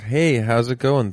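ordinary delivery

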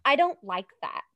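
emphatic little refusal, imitating her daughter; child refusing. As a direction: lightly mimic a child saying no. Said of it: "that's the first thing".

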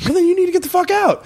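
very high, retold angry comeback; heated retort. Shut out by relatives at the delivery, heated retort. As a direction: push back hard in a high, heated voice. Retold quarrel at operating room door.

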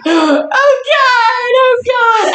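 high, drawn-out mock wail; panicked niece. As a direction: imitate the niece wailing in panic. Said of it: "And she's like".

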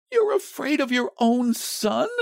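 put-on high-pitched, reproachful old woman; incredulous cry. A narrator's reading. Wife struggling against her frightened husband, incredulous cry. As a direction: throw it at him high and disbelieving. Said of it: "she cried, struggling".

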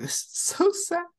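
high, whiny mock lament; mock sad. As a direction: whimper it in a high pitch. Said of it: "and I was like".

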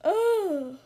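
loud effortful grunt; puppy squeezing through a small hole; strained grunt. A narrator's reading. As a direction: strain hard as you push through.

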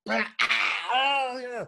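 high yelp of sudden pain, cut off. Retold or imitated. Hitting his thumb while building a bookcase, high pained yelp. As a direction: yelp like you just smashed your thumb.

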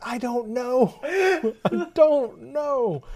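high, stretched-out mock wail; helpless bewilderment. Gamer answering girlfriend about the game, helpless mock wail. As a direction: drag it out helplessly, laughing by the end.

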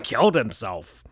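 ordinary delivery, nothing marked

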